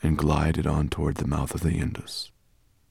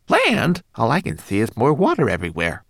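"Land?" very high and louder, then grumbling; a small creature, fed up; cross Twigleg; 7 semitones higher, 5 dB louder than usual. A narrator's reading. Twigleg complains to Ben, higher, louder and cross. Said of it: "he said crossly".

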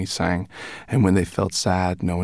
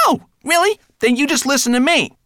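very high, loud challenge; indignant rat; 8 dB louder than usual. A narrator's reading. Rat squaring up to brownie, indignant. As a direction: demand to be heard, shrilly. Rat furious at being dismissed.

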